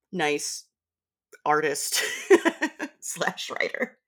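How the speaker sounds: normal talking voice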